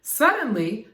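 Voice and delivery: usual speaking voice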